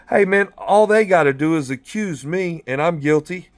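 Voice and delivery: normal talking voice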